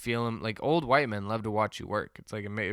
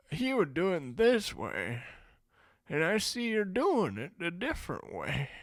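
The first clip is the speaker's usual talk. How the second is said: put-on old man reminiscing; 7 semitones higher than usual. Old man correcting how I work, high-pitched rambling. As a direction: ramble on like an elder comparing methods. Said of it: "He goes".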